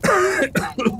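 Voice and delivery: rough cough from smoke; choking cough. Acted. Smoke-choked sheriff.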